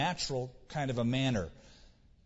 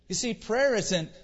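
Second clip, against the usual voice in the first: normal talking voice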